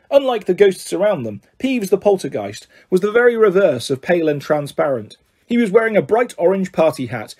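usual speaking voice

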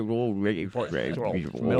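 put-on British accent garbled as if through toffee; mock British accent. Friends joking that British people have toffee mouths, mock British. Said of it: "They've got toffee in their mouths".

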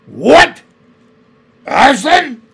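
the name hits her like a blow; cried out. A narrator's reading. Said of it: "cried the queen".

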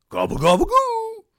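very high, loud cartoon dog gobble; Scooby-Doo voice. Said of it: "I'm gonna try my Scooby-Doo gobble gobble".

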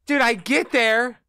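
high, frantic ranting; can't believe the bad luck; worked up. Real, not acted. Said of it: "And I almost lost it".

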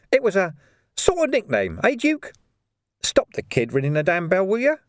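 jeering street thug; menacing thug voice. Acted. Carcer's voice, higher pitch.

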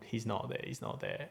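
whispered under the breath; nervous whisper to himself; hushed whisper. Retold or imitated. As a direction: breathe it, barely audible. Peeking out after scare, whispering.

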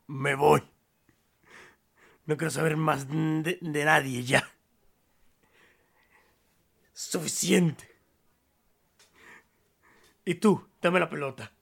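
fed-up. Acted. Old man storms off.

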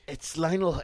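usual speaking voice

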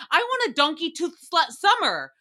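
normal talking voice

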